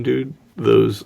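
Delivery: ordinary talk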